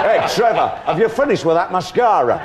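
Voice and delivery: high, loud camp northern call; Yorkshire accent. Retold or imitated. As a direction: shout across the dressing room, Yorkshire. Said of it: "my band were all from Yorkshire".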